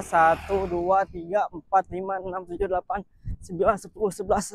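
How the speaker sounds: counting aloud while running, getting winded; out of breath running. Real, not acted. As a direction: count as you jog until you're tired. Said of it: "Capek, woi".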